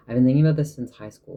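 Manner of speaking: fast and loud, eager; excited rush. Real, not acted. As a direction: blurt it out with excitement. Enthusiastic about an old idea.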